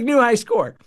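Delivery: high, loud game-show cheer; mock game cheer. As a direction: announce it like an arcade record. Joking about the breathalyzer reading.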